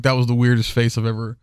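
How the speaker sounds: normal talking voice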